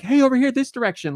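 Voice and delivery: high, quick calling flower voice; talking flower. Retold or imitated. Flower guiding the climbing player, high quick call. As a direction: beckon quickly in a high imitated voice. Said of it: "hear other flowers talking to you".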